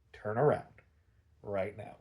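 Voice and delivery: low, soft acted menace; deadpan order. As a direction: give the command quietly, as a deadpan villain threat. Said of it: "deadpanning the guards".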